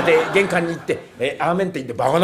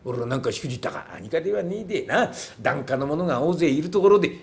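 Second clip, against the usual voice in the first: ordinary talk, nothing marked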